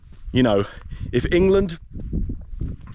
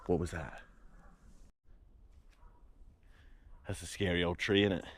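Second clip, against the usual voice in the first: hushed and spooked; 5 semitones lower, 14 dB quieter than usual. Real, not acted. Spooked by noise in forest.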